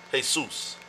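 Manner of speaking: "Jesus" said hay-SOOS, softly; Spanish pronunciation. Shown as a demonstration.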